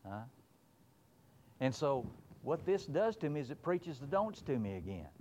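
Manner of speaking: usual speaking voice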